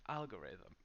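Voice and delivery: algorithm with stress on the first syllable AL; algorithm said carefully. Shown as a demonstration. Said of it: "the stress is in the first syllable".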